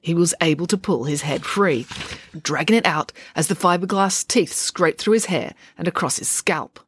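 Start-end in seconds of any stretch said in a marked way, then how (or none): none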